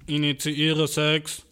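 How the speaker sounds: slow, loud robot monotone; slow mechanical command. Retold or imitated. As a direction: state it like a machine. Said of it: "wer redet so initiieren?".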